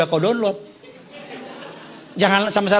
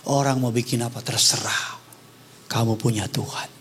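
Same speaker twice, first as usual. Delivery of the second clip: mother comforting; 12 semitones lower, 6 dB quieter than usual. Retold or imitated. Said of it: "Dia bilang sama saya".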